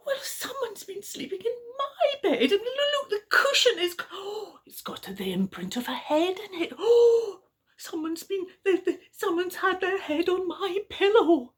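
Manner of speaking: high, fluttery and put out; Mummy Bear. Acted. Storyteller voicing Mummy Bear finding the pillow dented, high and fussy. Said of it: "And Mummy Bear said".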